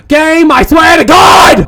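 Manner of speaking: loud, high, slow shout; drawn-out theatrical fury; exasperated yell. Acted. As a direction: bellow a warning at the game. Yelling at the looping game.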